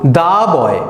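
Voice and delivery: "দা" with an open a vowel before "boy". Shown as a demonstration. Teacher showing the consonant-sound rule, "দা" said as "da". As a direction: say "দা" as "da", not "dee". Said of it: "তখন কিন্তু আমরা দা পড়ব".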